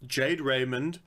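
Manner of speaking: usual speaking voice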